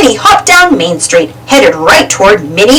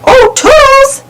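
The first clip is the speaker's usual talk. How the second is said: high called out Toodles; Mickey calling his helper; 13 semitones higher, slower than usual. A narrator's reading. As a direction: call for Toodles, high and bright. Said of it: "Mickey called".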